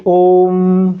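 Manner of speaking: "ओम" stretched evenly into a long hum on म; prolonged vowel. Shown as a demonstration. Teacher repeating the long reading for students, "ओम" held out. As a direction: sustain "ओम" in one unbroken level breath. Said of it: "इस तरीके से नहीं उच्चारण करते".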